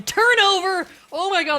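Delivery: high, loud exclamation; surprised shout. Real, not acted. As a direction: blurt it out in shock. Game turnover exclamation.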